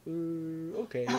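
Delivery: ordinary talk, nothing marked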